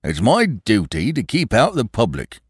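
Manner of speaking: a touch of sullen gloom in the inspector's voice; morose Sugg. A narrator's reading. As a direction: say it like a policeman sick of the job. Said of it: "said Inspector Sugg morosely".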